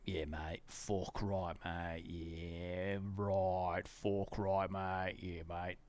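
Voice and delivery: put-on deep lad voice; deep mock bloke. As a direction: play a dopey mate agreeing.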